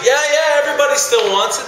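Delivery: high, quick eager crowd voice; eager students. Retold or imitated. Students clamoring for the twenty: high, eager, quick.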